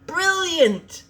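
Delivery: high, loud delighted yell; excited yell of praise. Acted. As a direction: shout it with delight. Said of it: "Robin yelled".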